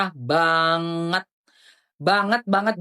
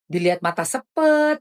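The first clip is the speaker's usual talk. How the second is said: normal talking voice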